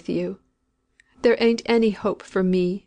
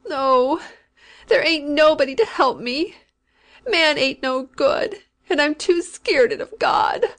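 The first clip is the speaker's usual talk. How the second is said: a touch higher, climbing toward panic; rising fearful voice; 6 semitones higher, 3 dB louder, slower than usual. A narrator's reading. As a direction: let the terror creep up in her voice. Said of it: "Her voice rose again into that shrill, intolerable shriek".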